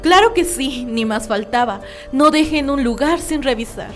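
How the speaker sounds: loud, high, insistent invitation; loudly putting on nothing-to-hide ease; over-eager assurance. Acted. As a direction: invite them in with too much confidence. Killer over-eagerly invites police search.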